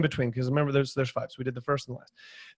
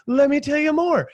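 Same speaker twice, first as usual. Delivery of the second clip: eager; 8 semitones higher than usual. Retold or imitated. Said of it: "they're like".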